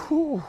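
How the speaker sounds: high breathy whew; high exhale. Real, not acted. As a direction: blow out after effort. Exhale after set.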